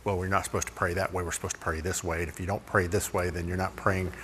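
low, soft, prim legalist voice; mock legalist. Preacher mimicking a legalist who says prayer has rules, low and hushed. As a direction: drop low and quiet to mimic the rule-keeper. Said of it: "Here's the thing about legalists".

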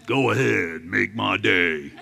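quiet, slow tough-guy drawl; soft and slow, daring him to move; Clint Eastwood impression. Preacher voices Jesus daring the devil, quiet slow tough-guy line. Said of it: "spirit of Clint Eastwood".

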